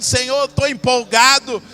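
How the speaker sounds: loud, bursting excited cry to God; hammed-up thrilled prayer. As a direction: burst out with joy. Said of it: "entrar dançando, pulando, saltando".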